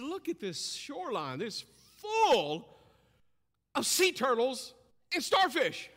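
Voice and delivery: put-on loud, high exclaiming old man. Preacher voicing the old man pointing at the shoreline, loud and high. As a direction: make him see the whole shore.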